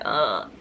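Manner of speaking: low, tired morning ugh; groggy groan. Retold or imitated. Answering her husband's wake-up call with a groggy groan. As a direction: grumble like you can't get up. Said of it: "and I'm just like".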